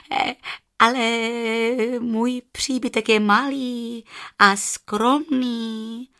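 high, stammering, nervous jackal; hesitant jackal. A narrator's reading. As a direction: agree haltingly, tripping over the words. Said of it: "odpověděl šakal".

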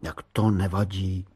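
ordinary delivery

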